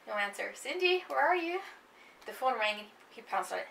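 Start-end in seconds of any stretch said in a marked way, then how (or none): 0.5-1.6 calling out. Acted. Husband calling through house.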